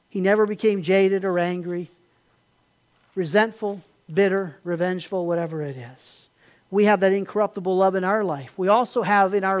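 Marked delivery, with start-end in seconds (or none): none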